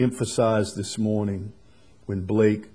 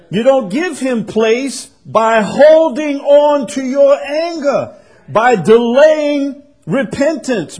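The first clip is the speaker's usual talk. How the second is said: impassioned preaching; 10 semitones higher, 7 dB louder than usual. Acted. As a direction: press the congregation hard on the warning. Preaching at full force.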